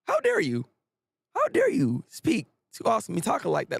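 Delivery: high indignant outburst; outraged. Real, not acted. Defending Asa Mitaka from the flamethrower guy, outraged. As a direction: snap back in offended disbelief.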